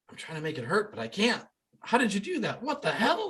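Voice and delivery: high, laughing disbelief; amazed disbelief. Retold or imitated. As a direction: squeak it out, laughing and stunned. Skeptics' minds being blown.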